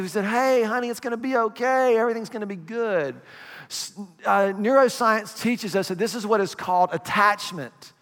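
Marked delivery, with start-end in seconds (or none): 0.2-3.2 higher, gentle parent voice; calm the baby down kindly; soothing parent. Retold or imitated. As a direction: parent comforting a crying infant.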